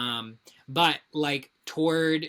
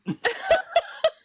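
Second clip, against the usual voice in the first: high laugh; 4 dB louder than usual. Real, not acted. Laughing at own pun.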